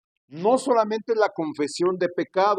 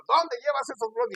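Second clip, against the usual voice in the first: mock shout, pitched higher; retold shout; 9 semitones higher than usual. Preacher yells at the thief from inside the shop, retold shout. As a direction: call out after the thief, half acted. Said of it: "Que le alcanzo a gritar de adentro".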